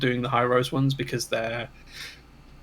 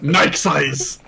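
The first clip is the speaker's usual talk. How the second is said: loud, mocking echo of the correction; mock repeat; 6 dB louder than usual. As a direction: throw "Nike size!" back, half-teasing. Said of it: "Right, okay".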